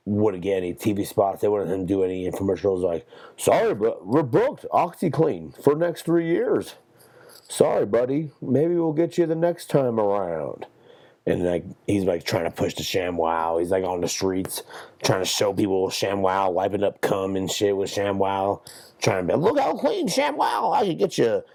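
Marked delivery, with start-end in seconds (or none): 3.4-10.7 higher, breezy brush-off voice; mock TV exec. Podcaster voices TV execs turning ShamWow guy away, high breezy brush-off. 19.4-21.4 very high, squeaky sales pitch; mock street pitchman. ShamWow guy pitching to people on the street, squeaky high sales voice.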